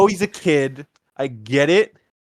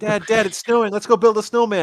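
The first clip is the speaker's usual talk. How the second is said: put-on pleading higher-pitched child; eager kid; 6 semitones higher than usual. Kid asking Dad to build a snowman, eager. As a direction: lightly voice an excited kid begging Dad.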